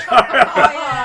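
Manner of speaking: big burst of laughter; loud laugh. Real, not acted. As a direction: laugh out hard. Laughs at nutshell joke.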